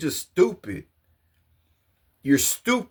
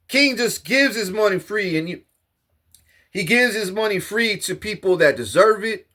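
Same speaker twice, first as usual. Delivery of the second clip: normal talking voice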